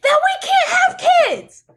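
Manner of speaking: very high, loud anguished shout; re-enacted scream. Wife answering husband about infertility, anguished re-enacted scream. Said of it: "I just screamed".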